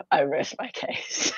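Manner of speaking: slow, breathless with laughter; laughing mock closing line. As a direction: fall apart laughing on the verdict line. Mock lawyer closing argument.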